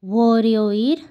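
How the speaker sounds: ওরিও with an r, not an l; r sound in ওরিও. Shown as a demonstration. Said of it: "এখানে এটা র এর উচ্চারণ হবে".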